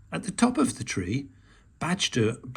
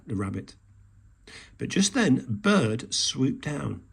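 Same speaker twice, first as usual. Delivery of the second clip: ordinary delivery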